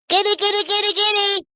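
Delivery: very high-pitched cat call. Real, not acted. Streamer breaks from greeting chat to summon the cat, calling in a high voice.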